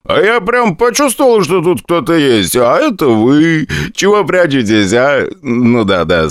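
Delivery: put-on happy drunk, words sliding upward. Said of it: "заявил он пьяным голосом".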